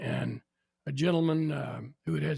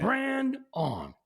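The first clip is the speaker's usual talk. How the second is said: higher, drawn-out roll call; old professor calling roll; 8 semitones higher than usual. Retold or imitated. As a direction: call the name like a stern old prof.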